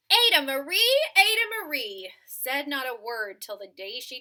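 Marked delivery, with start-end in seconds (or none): 0.1-2.1 loud sing-song call of a name. Acted. Parents calling their silent toddler, loud sing-song call. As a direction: call her name across the house.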